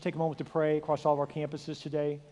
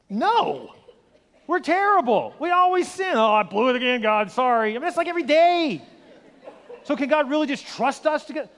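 loud, high, fervent preaching; impassioned sermon climax; preaching at full force; 10 semitones higher, 13 dB louder than usual. Acted. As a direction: drive the point home to the congregation.

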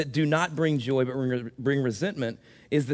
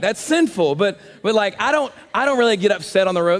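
normal talking voice

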